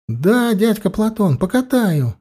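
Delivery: put-on boy answering uncle Platon eagerly, high child voice; boy exclaiming. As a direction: play an excited small boy shouting yes. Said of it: "воскликнул малец".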